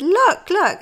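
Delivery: put-on high, loud excited child voice; child calling. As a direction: point it out eagerly, like a little girl. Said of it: "and she said".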